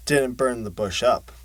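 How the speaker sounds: ordinary delivery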